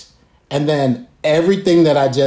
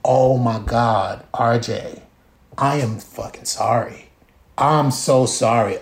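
mild remorse; 6 semitones lower, 3 dB quieter than usual. Retold or imitated. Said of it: "And I was like".

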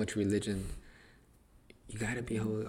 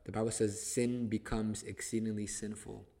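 ordinary talk

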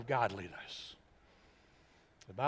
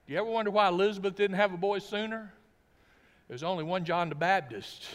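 usual speaking voice

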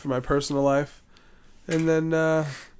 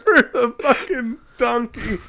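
laughing hard, higher; 9 semitones higher, 3 dB louder than usual. Real, not acted. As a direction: crack up mid-sentence.

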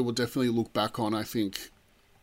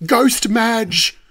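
high, loud exclamation; excited shout; 9 semitones higher, 9 dB louder than usual. Real, not acted. Host recalling the Neighbours finale's ghost moment, excited shout. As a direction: cry it out, thrilled and overcome.

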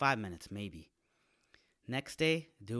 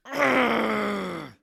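much louder, higher hammed-up roar of release; mock climax roar; 9 semitones higher, 12 dB louder than usual. Future listener finishing, a comic much louder Hulk roar. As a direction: bellow it out like the Hulk. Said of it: "You're gonna go from ... to".

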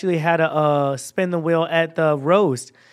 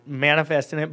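ordinary talk, nothing marked

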